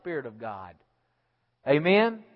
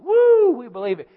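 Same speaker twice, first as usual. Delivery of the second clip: louder, higher whooping cheer; mock crowd cheer; 7 semitones higher, 9 dB louder than usual. Preacher imitating the stadium crowd shouting, mock cheer.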